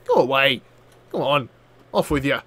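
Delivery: low, soft, offhand imitation; casual dismissal. Player imitating the casually standing pirate, casual dismissal. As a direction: mimic someone casually waving you off. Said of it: "He was standing there so casually".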